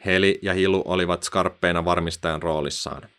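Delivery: plain reading voice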